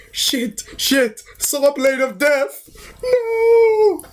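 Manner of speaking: high mock-terrified yelping; hammed-up panic, pitch climbing sharply; fretting panic. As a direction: build to a squealed, falsetto cry. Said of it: "He was, like, fretting his ass".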